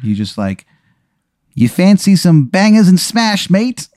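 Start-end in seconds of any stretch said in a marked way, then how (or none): 1.5-3.9 high, hammy fake cockney accent; bad English accent. Eddie English offers the player food, bad English accent. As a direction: overplay a Brit offering food. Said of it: "an American trying to do an English accent".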